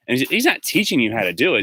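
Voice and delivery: ordinary delivery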